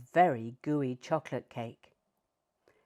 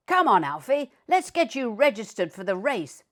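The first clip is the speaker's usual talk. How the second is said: louder, higher upbeat owner; cheery Master; 4 semitones higher, 6 dB louder than usual. A narrator's reading. Master urging Alfie to register, louder and cheerful. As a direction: call the dog along brightly. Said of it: "said Master".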